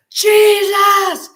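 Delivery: high, loud shout of the name; screamed name. Retold or imitated. Finally recognizing the name, screaming it in the bedroom. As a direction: cry out the name with full force. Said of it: "And I screamed it".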